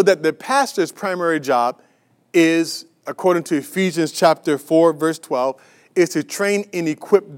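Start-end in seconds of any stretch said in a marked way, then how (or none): none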